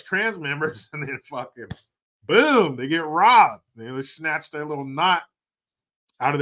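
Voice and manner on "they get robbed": usual speaking voice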